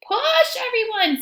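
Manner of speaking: very high, loud pig shout; a little pig urging the animals on; Piggy shouting. Acted. Piggy urging everyone to push, high and loud. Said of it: "says Piggy".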